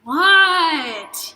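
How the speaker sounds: loud, high, drawn-out whine; stretched whiny complaint, falling; whining. A narrator's reading. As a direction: whine it out in protest. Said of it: "you whine".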